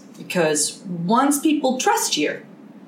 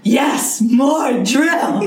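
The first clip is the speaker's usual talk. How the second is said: Steve Martin impression; 6 semitones higher, 5 dB louder, much slower than usual. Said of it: "Steve Martin's dancing around".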